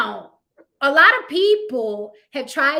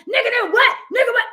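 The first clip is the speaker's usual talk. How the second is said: much higher, louder mocking street yell; mock rapper shout; 11 semitones higher, 7 dB louder, faster than usual. Mocking singers in jail garb, loud high rapper yell. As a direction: bark it like a hyped rapper. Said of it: "with their Timberland".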